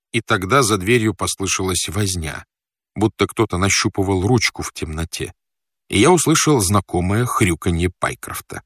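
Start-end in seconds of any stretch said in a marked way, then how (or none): none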